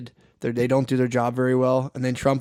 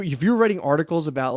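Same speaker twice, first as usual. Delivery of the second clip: ordinary talk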